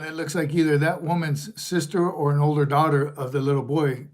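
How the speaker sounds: normal talking voice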